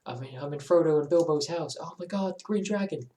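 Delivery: fan in awe. Retold or imitated. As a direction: gush like a Tolkien fan at Hobbiton. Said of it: "you're like".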